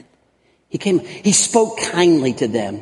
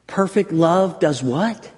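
ordinary talk, nothing marked

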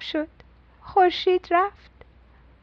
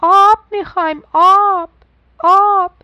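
louder, higher call for water; fish shouting; 5 semitones higher, 7 dB louder than usual. A narrator's reading. Thirsty fish calling out for water, louder and higher. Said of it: "ماهیا داد زدن".

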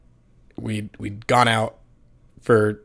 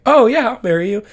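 high, louder, imitating the fiancee's casual yes; mock fiancee yes; 11 semitones higher, 7 dB louder than usual. Fiancee accepting the proposal. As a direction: toss off an easy yes as her. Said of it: "She's like".

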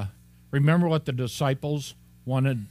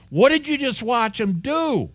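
higher, louder, incredulous challenge; exasperated; 11 semitones higher, 6 dB louder than usual. Real, not acted. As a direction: demand it in disbelief.

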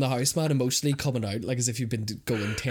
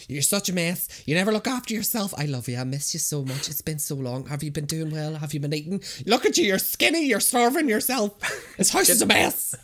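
mum's nagging voice; 6 semitones higher, 4 dB louder than usual. Retold or imitated. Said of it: "They'd be like".

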